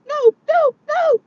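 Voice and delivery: put-on very high-pitched little-girl refusal; tiny, high mock-kid voice; imitating daughter. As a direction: mimic a child's squeaky protest. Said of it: "she'd be like".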